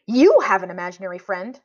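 plain reading voice

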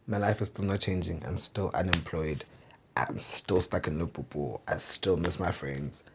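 low, soft and downcast; deflated, low-energy recounting of being stuck; dejected. Retold or imitated. Recounting goals that failed, still stuck.

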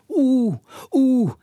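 high, loud hooting owl call. Acted. Owl Jodocus hooting from the castle tower, loud. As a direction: hoot like an owl into the dark. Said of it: "riep Josse".

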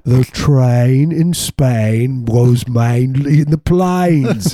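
put-on slow, over-articulated English accent lesson; My Fair Lady English accent drill, drawn out; mock English elocution teacher. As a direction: stretch each vowel as an English diction coach. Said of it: "you're trying to teach them".

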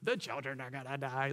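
hammed-up wail of worry; mock panic. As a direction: moan it like an overdramatic complainer. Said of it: "I can't help but laugh a little bit".

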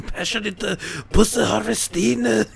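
mock Japanese accent. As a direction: drag it out in a hammed-up fake accent.

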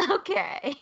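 high, amused little okay; mock bemused acceptance. Host reacting to a petty book review, bemused high okay. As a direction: humor the ranter in a high voice. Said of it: "I was just like".

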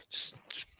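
whispered fumbling words. Real, not acted. Describing the locker room chaos, whispered. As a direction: drop to a whisper mid-thought. Describing Bullet Club going nuts.

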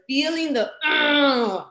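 high, strained mock growl of anger; frustrated groan. Showing how she felt the trigger, frustrated groan. Acting out feeling her anger.

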